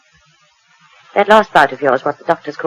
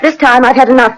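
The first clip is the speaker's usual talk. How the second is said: raised quarrel; 6 semitones higher, 6 dB louder than usual. Acted. Couple quarrelling, raised voices.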